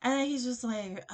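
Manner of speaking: normal talking voice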